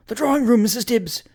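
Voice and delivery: high, urgent astonished exclamation; startled, pitch jumping up. A narrator's reading. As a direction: urge her on in startled haste. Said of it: "whispered the astonished Evanson".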